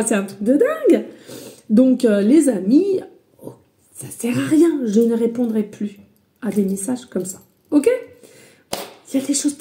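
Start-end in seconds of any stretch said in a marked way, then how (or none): none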